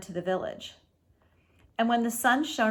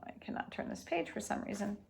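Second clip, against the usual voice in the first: muttered aside; 11 dB quieter, faster than usual. Real, not acted. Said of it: "aside about the page".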